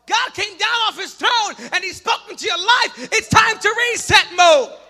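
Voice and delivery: loud, rising preacher's cry, building; full-force preaching. Acted. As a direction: preach it to the rafters, climbing.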